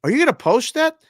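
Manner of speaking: high, loud alarmed wife; the embarrassed wife panicking. Retold or imitated. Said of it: "she goes".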